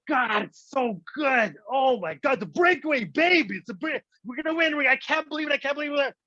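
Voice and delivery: high-pitched, hammed-up elation; ecstatic excitement. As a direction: squeal like an overjoyed hockey fan. Acted ecstasy at hockey breakaway.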